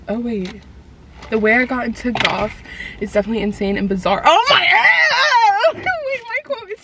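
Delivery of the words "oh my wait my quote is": high, loud, laughing shriek of surprise; excited squeal. Real, not acted. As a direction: squeal with delight at the discovery. Finds herself quoted in article.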